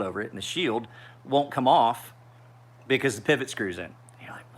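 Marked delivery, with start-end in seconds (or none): 3.8-4.6 breathy whisper before the outburst; a hushed aside before exploding; whispered lead-in. Real, not acted. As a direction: drop to a whisper to set it up. Building to exasperated outburst.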